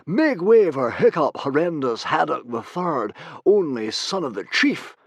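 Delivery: loud proud announcement; shout. A narrator's reading. Humongous announcing Hiccup behind him, loud proud shout. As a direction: herald him through the crowd.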